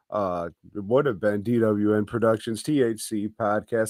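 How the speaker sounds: normal talking voice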